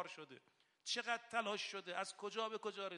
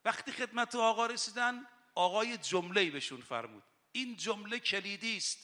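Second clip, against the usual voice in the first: loud, impassioned rowza sermon; rising impassioned sermon voice; fiery preaching; 10 dB louder than usual. Acted. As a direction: preach it out with full fervour. Impassioned preaching about duty.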